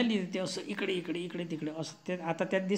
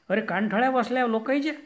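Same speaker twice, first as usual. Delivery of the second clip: mock scolding; 6 semitones higher, 6 dB louder, faster than usual. God scolds the loud donkey.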